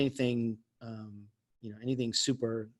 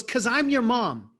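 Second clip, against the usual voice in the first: stern mom; 8 semitones higher, 4 dB louder than usual. Retold or imitated. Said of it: "And she goes".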